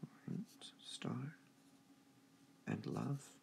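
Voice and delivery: star and love whispered; teacher whispering the stressed words. Shown as a demonstration. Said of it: "repeats stressed words softly".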